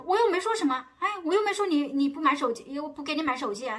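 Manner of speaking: high, loud mock defensive protest; defensive husband. Husband defending himself to wife, loud mock protest. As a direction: protest loudly that you said nothing.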